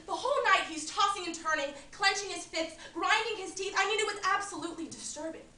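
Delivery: disturbed exclamation. Acted. [name] tells the audience how unsettling Barry's night was, excited. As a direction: push it out like you still can't believe it.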